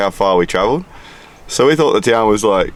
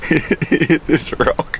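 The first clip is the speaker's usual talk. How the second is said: slow, higher, through real laughter; laughing while talking; 7 semitones higher, 5 dB quieter, much slower than usual. Real, not acted. Friend retelling a mishap on the track, laughing helplessly. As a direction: struggle to tell it through giggles.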